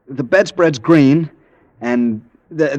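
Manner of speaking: ordinary talk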